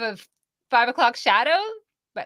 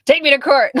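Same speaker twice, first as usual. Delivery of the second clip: loud, higher-pitched mock challenge; J K Rowling daring; 6 semitones higher, 12 dB louder than usual. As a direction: throw down a loud, defiant dare. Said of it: "she's like".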